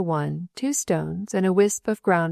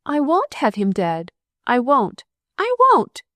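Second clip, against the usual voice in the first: building to a high, loud scream; rising into a stormy scream; 7 semitones higher, 3 dB louder than usual. A narrator's reading. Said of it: "she screamed out stormily".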